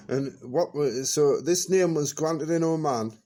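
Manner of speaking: usual speaking voice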